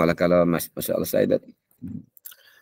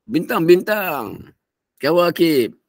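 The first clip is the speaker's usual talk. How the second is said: put-on higher, slow child's voice; child answering; 8 semitones higher, much slower than usual. Son downstairs answering his father, high and slow. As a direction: answer proudly like a little boy. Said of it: "Anak jawab".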